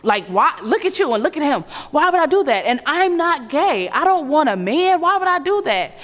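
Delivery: mock desperate excuses; pleading husband. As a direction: build into desperate denial. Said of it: "things anybody would say".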